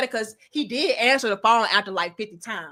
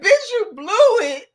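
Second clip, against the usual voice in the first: loud, high, drawn-out self-scolding; reenacted exasperated outburst; mad at herself; 9 semitones higher, 5 dB louder, slower than usual. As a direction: scold yourself at full pitch. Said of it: "I was mad as fuck".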